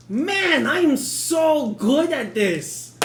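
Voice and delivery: smug boast. Real, not acted.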